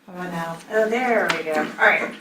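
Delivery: ordinary talk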